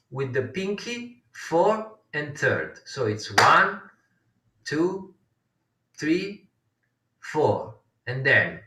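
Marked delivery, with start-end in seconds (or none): 3.4-7.7 spaced-out count, a pause after each number; one number per beat, separated. Shown as a demonstration. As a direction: count slowly along with finger taps. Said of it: "the way they count this".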